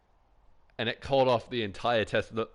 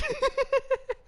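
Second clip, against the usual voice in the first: squealing laugh that jumps way higher; high shrieking laugh. Real, not acted. Streamer losing it at his own joke, shrieking with laughter. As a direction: let out a high, piercing cackle. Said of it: "my fucking ears hurt from laughing".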